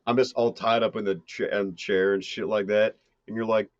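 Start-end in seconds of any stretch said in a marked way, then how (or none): none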